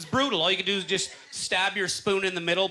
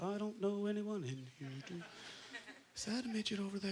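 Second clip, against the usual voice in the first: murmured under the breath, much softer; quiet inner thought; 2 semitones higher, 15 dB quieter than usual. Retold or imitated. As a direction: mumble it to yourself while scanning the room.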